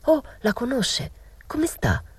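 breathless, hushed worried question; anxious whisper. Acted. Narrator anxiously asking the old woman about her friend, whispered.

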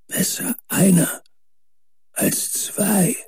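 high, distant whisper trailing off; Warren's whisper. A narrator's reading. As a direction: breathe the warning as if losing strength. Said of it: "Doch sein nächstes Flüstern".

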